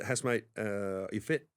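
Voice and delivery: lightly attempted coach's accent, drawn-out opening; Warren Gatland's accent. Coach phoning a player to check fitness, lightly imitated accent. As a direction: imitate the coach's accent a little, slow into the question. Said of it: "it sounds like Eddie Jones' accent".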